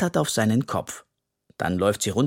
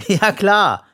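higher, louder sarcastic yeah right; eye-rolling; 8 semitones higher, 8 dB louder than usual. A narrator's reading. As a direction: roll your eyes at him. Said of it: "verdreht die Augen".

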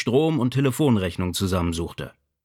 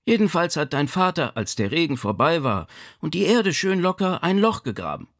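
higher-pitched mother's voice, telling what happened; lightly voiced mother reporting events; mother recounting a mishap; 6 semitones higher than usual. A narrator's reading.

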